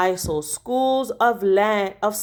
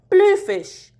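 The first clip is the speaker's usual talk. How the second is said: sudden high burst on the word; excited exclamation; 10 semitones higher, 4 dB louder than usual. A narrator's reading. Boy in the boat spots the bluefish, startled burst. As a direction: blurt it out, startled. Sudden sight of predator fish.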